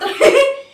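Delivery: high, loud gleeful laugh; shrieking laugh. Real, not acted. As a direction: shriek with winner's glee. Wife gloats over winning.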